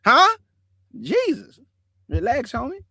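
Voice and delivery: high, startled huh then a sighing relax; mock the stranger's snap, then calm him, high; mocking replay. Retelling the stranger's reaction: high startled huh, then calming.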